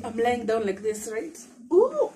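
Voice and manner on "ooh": sharp high yelp of strain as she moves; a sudden high cry from the effort of turning in bed; real ooh of effort, loud and high; pained ooh. Real, not acted.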